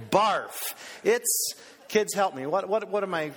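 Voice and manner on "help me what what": ordinary talk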